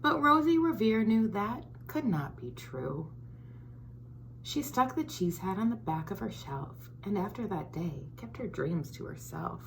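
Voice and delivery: put-on lively storybook read-aloud for kids; reading to children. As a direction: read it like story time for little ones. Reads picture book to children.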